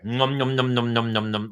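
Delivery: rapid run of nom-nom noises; greedy mock slurping at a tap; imitated gulping. Thirsty person drinking porta potty water, mock gulping.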